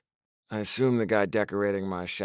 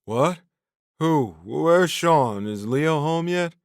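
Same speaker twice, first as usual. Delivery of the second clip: loud, high, bewildered questions; stoned and lost, asking around; confused drunk; 7 semitones higher, 7 dB louder, slower than usual. A narrator's reading. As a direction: drunk stranger confused at the wrong door.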